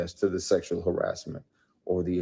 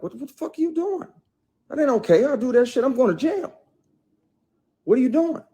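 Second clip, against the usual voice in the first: high-pitched, raised-voice retold outrage; indignant retort; 6 dB louder, faster than usual. Him confronting the actress who groped him, indignant. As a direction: act out an alarmed, indignant telling-off.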